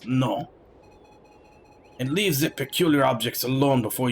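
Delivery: put-on grumbling Frenchman with a heavy accent. French soldier scolding companions in the wilderness, heavy French accent. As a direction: read it in a thick, disdainful French accent. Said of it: "as if he's speaking with a French accent".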